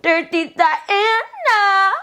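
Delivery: high, slow, drawn-out announcement; drawn-out name. Retold or imitated. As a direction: stretch her name out like a big reveal. Dramatic reveal of returning roommate.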